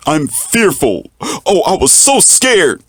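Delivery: high, mocking fearful whine; imitating a fearful man, hammed up; mock scared voice. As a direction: pitch up to mock a scaredy-cat.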